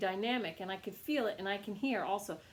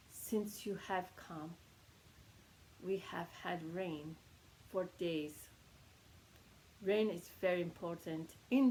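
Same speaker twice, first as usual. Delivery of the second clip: solemn teacher, soft, with long weighty pauses; 4 semitones lower, 8 dB quieter than usual. Retold or imitated. Korean teacher gravely thanking students for bringing rain, very solemn. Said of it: "says very solemnly".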